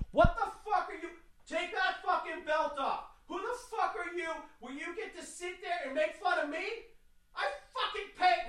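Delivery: high, strained scream held back in volume; distant, muffled screaming fit; mock screaming tirade. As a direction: rant furiously in a soft, high-pitched mock yell. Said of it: "I let off a fucking tirade".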